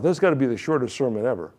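ordinary talk

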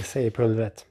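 ordinary delivery, nothing marked

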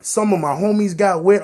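ordinary talk

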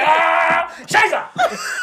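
mock German dad. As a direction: burst in yelling like a cartoon German. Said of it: "Dad walks in like".

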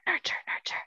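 soft whispered repeat of nurture. Acted. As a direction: breathe the word nurture twice. Whispered repetition in lecture.

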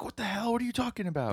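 imitating her in a high-pitched huff; mock angry outburst. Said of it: "she gets super angry".

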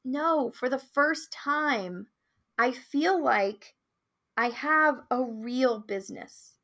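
slow, emphatic retold quote; heartfelt realization. Quoting a client's realization to the host, slow and heartfelt. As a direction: draw it out, weighting each phrase.

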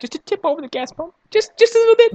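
tiny high-pitched voice, quick and small; sarcastically delicate falsetto; mock dainty. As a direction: squeak it out to mock a feeble bump. Mocking the tiny impact.

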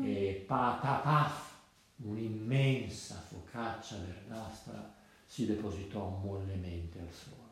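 low, slow, weighty telling; ponderous storybook description; heavy plop narration. A narrator's reading. Narrator imitates heavy cowpat landing.

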